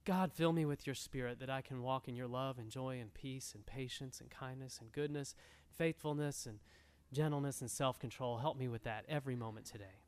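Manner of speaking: soft, low, humble plea to God; a hint of a quiet earnest prayer; whisper-soft cry for help from the Lord; pleading prayer. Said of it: "you just cry out to God".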